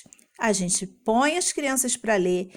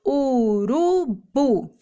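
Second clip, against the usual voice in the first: "urubu" very slowly as u-ru-bu; three long drawn-out beats in "urubu"; one syllable at a time; much slower than usual. Shown as a demonstration. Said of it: "pronunciando uma sílaba de cada vez".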